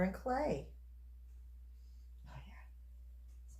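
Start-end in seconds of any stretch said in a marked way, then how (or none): 2.3-3.6 soft breathy whisper; soap cutting asmr. Real, not acted. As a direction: whisper it, pleased with the bar. Whispered reaction to cut bar.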